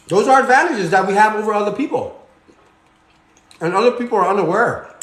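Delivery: talking with a mouth full of food. Real, not acted. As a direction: speak through a mouthful. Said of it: "I shouldn't be talking with my mouth full".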